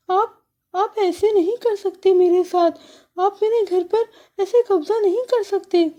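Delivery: high, pleading little sparrow; small bird, high and upset; protesting sparrow. Acted. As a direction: sparrow begging him to stop.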